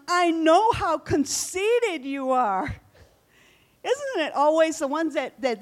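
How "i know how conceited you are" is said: slow, loud, scornful accusation; contemptuous brother. Retold or imitated. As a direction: drag it out to belittle him. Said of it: "And then he goes on".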